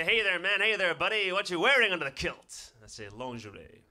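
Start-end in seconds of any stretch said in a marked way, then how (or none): 0.0-2.7 loud, high, pushy lad; brash passer-by. Retold or imitated. Lad asking what he wears under the kilt, loud and brash. Men heckle him about kilt. 3.1-3.8 softer, understated one-word answer; deadpan comeback. Retold or imitated. Answering the lad about his kilt, deadpan and quiet.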